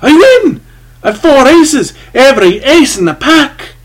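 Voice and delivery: very high, loud shriek of joy; shriek of triumph. A narrator's reading. Gambler claiming the pot, joyful shriek. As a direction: crow your win at full volume. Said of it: "his own well-simulated shriek of joy and triumph".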